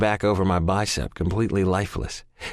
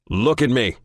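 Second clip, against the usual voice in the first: shouting; 5 semitones higher, 4 dB louder than usual. Acted. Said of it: "he shouts".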